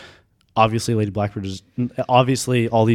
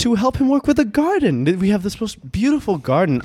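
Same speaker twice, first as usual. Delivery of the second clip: haughty high lady voice; 8 semitones higher than usual. Acted. Lady explains wanting the goblin.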